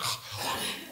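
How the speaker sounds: sharp breathless gasp of amazement; amazed gasp. Retold or imitated. Reader discovering the Bible's riches, breathless gasp. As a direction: gasp as if struck by wonder. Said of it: "You'll be like".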